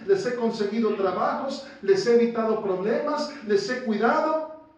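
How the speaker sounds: loud, high, pleading sermon voice; preacher acting out a protesting father; full-force preaching. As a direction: press the point like an indignant parent. Preacher voicing a parent's protest.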